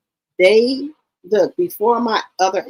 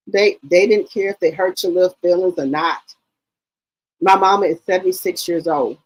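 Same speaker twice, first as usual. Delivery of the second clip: ordinary talk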